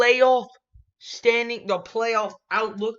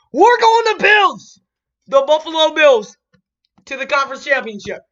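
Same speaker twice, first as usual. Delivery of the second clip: high loud triumphant yell, slowed down; excited shout; 6 semitones higher, 8 dB louder than usual. Real, not acted. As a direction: shout the bold pick with glee. Bold upset pick called out.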